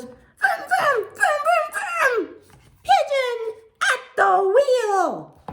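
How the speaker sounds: very high pigeon voice making engine noises; pigeon playing driver. Pigeon pretends that he's driving, high excited play voice. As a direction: squeal the vrooms, then stretch out a proud announcement. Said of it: "the pigeon pretends that he's driving".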